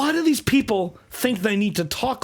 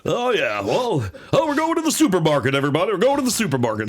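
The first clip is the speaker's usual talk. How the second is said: singsong mock broadcaster hype; dumb announcer voice. Announcer telling everybody about the supermarket, hammed-up voice. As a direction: announce an errand like a big event. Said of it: "That is just his dumb voice".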